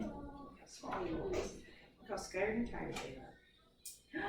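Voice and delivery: hushed whisper. Acted. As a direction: draw the kids in with a whisper. Soft storytelling moment.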